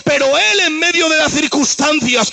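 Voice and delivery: rising, chanting sermon cadence; preaching. Acted. Preacher driving the point home to the congregation, building and chanting.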